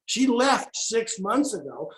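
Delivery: "left" leaned on, slow and deliberate; slow down and land on "left"; "She left six months ago" said slowly with weight on "left"; stress on left. Real, not acted. Correcting the lady's belief.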